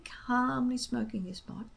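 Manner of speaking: ordinary delivery, nothing marked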